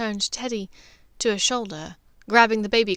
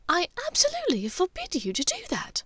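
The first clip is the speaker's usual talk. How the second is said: a higher voice, firm and final; firm forbidding mother; 10 semitones higher than usual. Acted. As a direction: forbid him outright, no room to argue. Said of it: "Narcissa said firmly".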